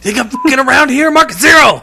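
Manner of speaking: high, loud laughing shout; laughing imitation of a furious shout; mock yell. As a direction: bark the threat in hammed-up fury. Friend joins quoting Walter.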